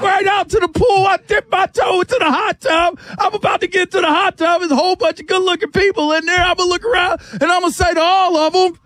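high-pitched, escalating mock hype; hyped party routine. Party guest working the room, playfully hyped.

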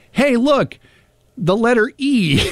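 high-pitched, simple-minded little discovery; mock childlike wonder. Host mocking their own dull first memory, mock wonder. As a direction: act mildly amazed by something trivial. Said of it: "Meanwhile, I'm just like".

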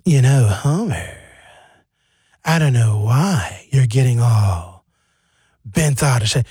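slow, drawn-out calm imitation; mock monotone. Host imitating co-host infuriating Homer, slow monotone. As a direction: drag it out, maddeningly unbothered. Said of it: "You keep the same monotone".

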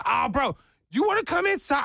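high, chummy impression of an overfriendly host; imitated eager invitation. Father-in-law inviting a sweaty worker inside, high eager voice. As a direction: invite him in eagerly, voice leaping up.

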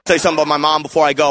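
ordinary talk, nothing marked

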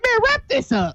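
high-pitched mock dismissal; imitating fed-up interviewer. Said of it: "You're like".

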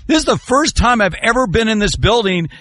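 high-pitched retelling of an astonished fan; imitated amused amazement. Host quoting his TV partner at the stadium, amazed. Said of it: "he was laughing".

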